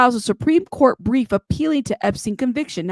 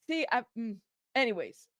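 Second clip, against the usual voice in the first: normal talking voice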